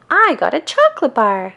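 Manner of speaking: high and loud delight; thrilled with her prize; excited. A narrator's reading. Patty showing off her candy, high and thrilled. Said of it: "exclaims Patty".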